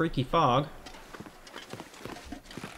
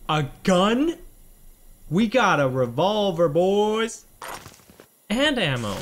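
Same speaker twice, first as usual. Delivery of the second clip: excited; 6 semitones higher, 6 dB louder, slower than usual. Real, not acted. Finds a gun in game.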